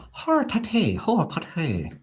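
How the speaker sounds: very high mock Borat-style accent; Borat-ish accent. Shown as a demonstration. Trying an accent out for Jeff, Borat-ish accent. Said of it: "it was Borat-ish".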